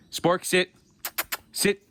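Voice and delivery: stern repeated sit commands; firm dog command. Real, not acted. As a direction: make the dog obey.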